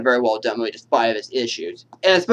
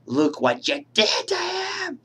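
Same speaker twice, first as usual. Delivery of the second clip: much higher, slow anguished cry; anguished wail; 3 dB quieter, much slower than usual. Acted. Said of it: "from Miss Voorhees when she says".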